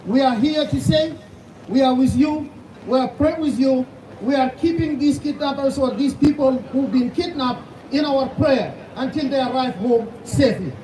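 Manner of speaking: usual speaking voice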